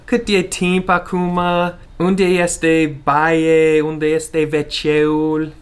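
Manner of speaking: mock American-accented Romanian questions. Shown as a demonstration. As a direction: spell out each word like a lost American tourist. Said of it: "When you should say".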